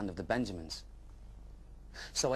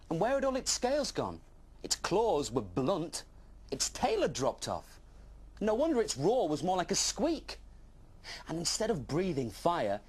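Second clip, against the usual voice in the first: put-on young dragon puzzled by the Benjamin; wondering narration; 7 semitones higher than usual. A narrator's reading. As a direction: marvel at each odd detail. Dragon marvels at strange Benjamin.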